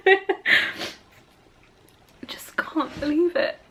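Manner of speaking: breathless, hushed disbelief after a sigh; awed whisper. Real, not acted. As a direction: whisper it as if you can't take it in. Overwhelmed author holding her book.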